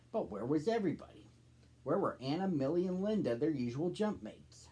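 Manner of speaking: gentle read-aloud storybook voice; story narration. A narrator's reading. Narrator reading a children's story aloud, gentle storybook voice. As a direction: read the tale to young listeners.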